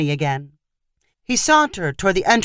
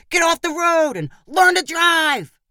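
high-pitched heckling yell; calling out; 11 semitones higher than usual. A narrator's reading. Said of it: "calling out things like".

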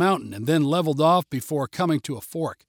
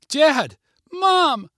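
high, loud frantic yell into the drop; 11 semitones higher, 6 dB louder than usual. A narrator's reading. Girl screaming for her fallen parents, loud and high. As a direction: shout for them, panicked. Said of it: "Kara yelled".

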